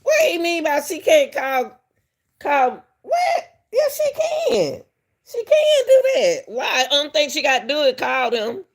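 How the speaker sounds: high-pitched, indignant disbelief; incredulous objection. Real, not acted. Host disputing that she can't call, incredulous.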